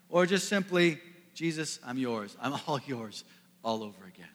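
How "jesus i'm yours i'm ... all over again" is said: hushed prayer. Acted. Worshipper's surrender prayer quoted.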